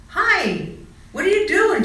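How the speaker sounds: excited caterpillar greeting; bright eager greeting. A narrator's reading. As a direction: greet them eagerly up high. Charlie greets the mice.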